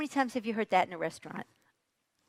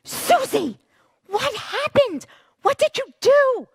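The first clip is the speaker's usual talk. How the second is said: high, loud angry scream; mother screams at her daughter; 11 dB louder than usual. Retold or imitated. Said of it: "and screams".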